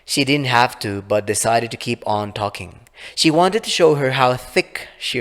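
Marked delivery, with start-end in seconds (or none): none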